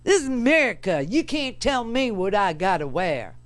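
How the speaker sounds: mock Southern good old boy, proudly outraged; Southern accent. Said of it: "a guy from Maryland a Southern accent".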